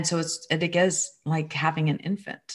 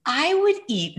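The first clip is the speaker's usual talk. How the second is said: a little boy, much higher, voiced, slowing down; child's voice; 11 semitones higher than usual. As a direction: say it in the son's voice, on the edge of a grin. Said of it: "now he's smiling and almost laughing".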